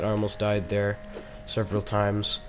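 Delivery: normal talking voice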